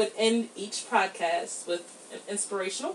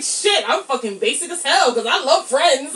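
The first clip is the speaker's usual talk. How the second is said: loud, higher retold outburst; amused realization; 5 semitones higher, 9 dB louder than usual. Podcaster admits she fits the video, loud amused dismay. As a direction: blurt it out loudly, half laughing.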